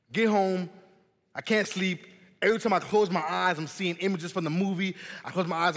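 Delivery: ordinary delivery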